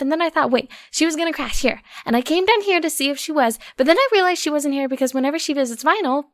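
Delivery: a panicked pony spilling everything in one go; Daisy, frantic rant. Acted. Daisy rushes to tell Octavia Roseluck vanished, frantic high babble. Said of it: "another heady rant".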